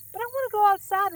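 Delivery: high, fast pleading little frog voice; the small frog begging to go out; eager little frog. Acted. Small frog begs the worm to let him outside, high and eager.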